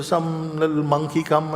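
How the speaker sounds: usual speaking voice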